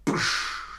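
one sharp voiceless "psch"; quick burst-of-air sound effect; imitated whoosh. Shown as a demonstration. Teacher marking the birth of a company, imitated whoosh.

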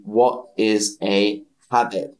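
usual speaking voice

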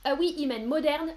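usual speaking voice

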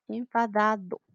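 usual speaking voice

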